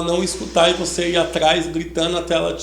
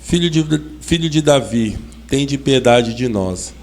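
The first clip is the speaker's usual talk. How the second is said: usual speaking voice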